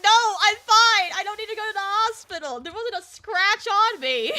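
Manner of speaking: high, loud retold protest; acted emphatic refusal; insistent protest. Insisting she is unhurt.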